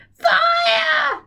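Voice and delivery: put-on very high screeching old woman; shouted. Grandma screams for the fire brigade, high screech. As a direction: screech it in panic. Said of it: "the old woman yelled".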